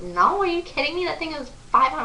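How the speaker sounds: high, mock-indignant protest; retold incredulous refusal. Fan answers her best friend, high incredulous protest. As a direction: pitch jumps up in disbelief.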